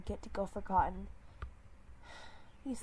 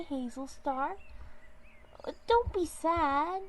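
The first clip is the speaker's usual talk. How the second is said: high, slow, louder little mew; ghost kitten voice; 7 semitones higher, 3 dB louder, slower than usual. Acted. Ghostly kitten comforting the sad leader, high. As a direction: coax him like a tiny ghost. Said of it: "the other one mewed".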